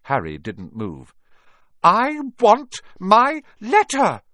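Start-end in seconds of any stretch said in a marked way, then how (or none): 1.8-4.2 slow, high, word-by-word yell; shouting. A narrator's reading. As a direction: shout each word as a demand. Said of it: "he shouted".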